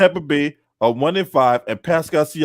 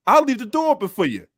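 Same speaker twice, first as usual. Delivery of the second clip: ordinary delivery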